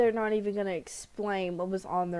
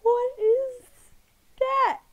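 high squealing laugh-cry at the screen; laughing shriek; 13 semitones higher, slower than usual. Real, not acted. Reacting to a weird arm, squealing with laughter.

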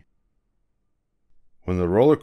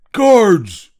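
high, much louder shout; shouting for guards; 12 semitones higher, 10 dB louder than usual. Acted. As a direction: call the guards across the park. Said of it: "shouted the ticket seller".

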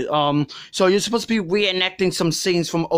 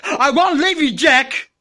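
overdramatic; 7 semitones higher, slower than usual. Acted. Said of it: "too dramatic".

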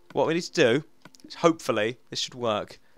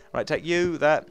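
ordinary delivery

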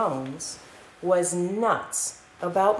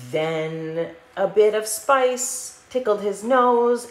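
ordinary delivery

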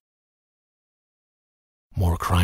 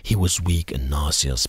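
ordinary delivery, nothing marked

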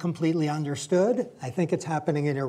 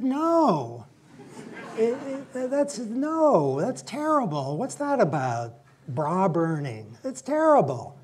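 imitating mother's outraged objection, much higher pitched; mother scolding; 7 semitones higher than usual. Son retelling his mother's outburst against feminism, mock-outraged and high. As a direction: play the hot-tempered mother pitched up high, half-mocking. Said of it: "she really let me have it".

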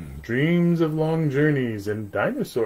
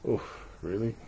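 ordinary delivery, nothing marked

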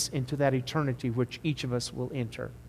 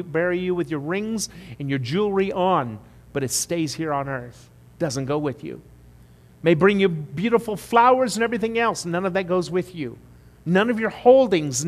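usual speaking voice

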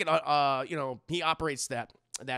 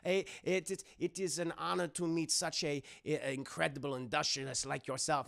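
hammed-up German doctor flattering a guest; Hugo Strange; 3 dB quieter than usual. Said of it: "a very thick German accent".

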